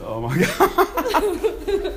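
slow, high laugh of disbelief; cracking up at a friend's joke. Real, not acted. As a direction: let the laugh stretch the words out.

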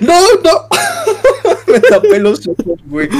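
loud, high burst of laughter around the words; cracking up. Real, not acted. Recalling covering his eyes, he bursts out laughing loudly.

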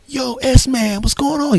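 high-pitched buddy hail; excited greeting. Retold or imitated. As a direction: imitate a pal thrilled to see a regular. Coworker greets a regular.

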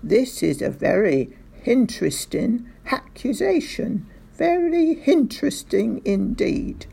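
hinteresting and haccusation with an H added. A narrator's reading. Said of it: "putting the letter H in front".

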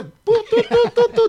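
high, loud phone beeps; imitated hang-up tone. Client hung up on the producer, loud high imitated beeps. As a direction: beep like a cut-off phone line.

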